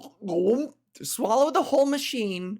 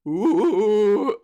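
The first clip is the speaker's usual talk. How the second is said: spooky ghost ooh; 7 dB louder than usual. Retold or imitated. Ghost floating away.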